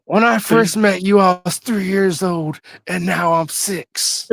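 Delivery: put-on a small boy cutting a promo, slowly; little kid imitating Hogan. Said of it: "Let me tell you something".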